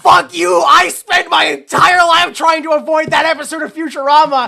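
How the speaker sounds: high, full-volume hammed-up rant; outraged shout. Viewer yelling at the film over a Futurama reminder, outraged shout. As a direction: yell it like a personal betrayal. Ranting at the film's joke.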